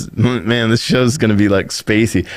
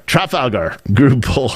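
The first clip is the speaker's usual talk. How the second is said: usual speaking voice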